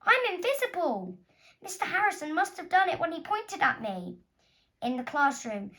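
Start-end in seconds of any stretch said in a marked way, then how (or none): 0.0-4.2 high alarmed rush. Acted. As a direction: tell it in rising alarm. Girl discovers she's invisible.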